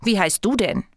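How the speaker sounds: sulky question thrown back; stubborn little retort; defiant. A narrator's reading. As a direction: ask back defiantly, stressing who is asked. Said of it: "fragt das Herz trotzig zurück".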